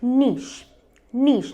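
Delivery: नीश with long "ee" and "sh"; one smooth syllable, नीश; correct pronunciation. Shown as a demonstration. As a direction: say नीश as "neesh". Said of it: "pronunciation है नीश".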